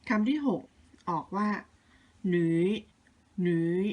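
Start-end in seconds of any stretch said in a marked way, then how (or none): 2.3-3.8 หนุย dipping down, then up; third tone. Shown as a demonstration. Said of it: "ลงแล้วขึ้น คือเสียงที่สาม". (ออกเสียง หนุย ลงแล้วขึ้น เป็นเสียงที่สาม)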